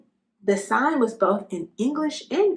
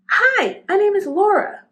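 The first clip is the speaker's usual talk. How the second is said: much higher, louder cheery hello; eager try-out; 11 semitones higher, 6 dB louder than usual. A narrator's reading. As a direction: test another name on yourself. Trying names at the mirror.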